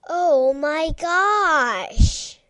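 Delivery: slow, loud drawn-out gasp of joy; joyful surprise. Acted. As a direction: stretch it out, thrilled to see the dog. Joyful final exclamation.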